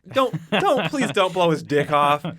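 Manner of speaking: mock pleading. Podcast host begs the character on screen, mock pleading. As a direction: plead with the movie character not to shoot.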